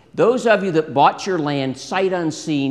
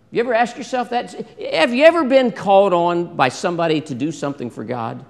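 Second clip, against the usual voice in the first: usual speaking voice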